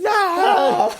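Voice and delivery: very high, loud comic "no"; over-the-top imitation of a distraught owner; mock wail. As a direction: cry out in hammed-up despair, laughing. Joins in mimicking the crying owner.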